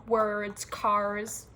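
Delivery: WORDS and CARS with heavy American Rs; American accent. Shown as a demonstration. Listing words they don't say that way, exaggerated American accent. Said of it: "I don't speak like that".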